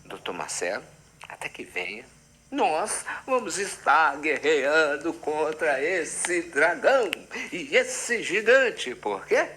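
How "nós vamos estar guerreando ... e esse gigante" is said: slow, grand knight's proclamation; Don Quixote. Acted. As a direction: declaim like a gallant knight going to battle. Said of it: "eu tô encarnado em Dom Quixote".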